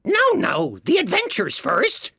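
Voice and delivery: high Gryphon, cutting in; impatient. A narrator's reading. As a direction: interrupt impatiently in a high creature voice. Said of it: "in an impatient tone".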